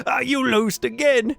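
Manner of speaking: high, slow taunting voice; gloating taunt. Acted. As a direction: rub in the win, slowly. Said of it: "and goes".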